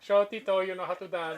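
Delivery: put-on light Filipino accent; a hint of a Filipino accent, teasing. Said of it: "I love doing a Filipino accent".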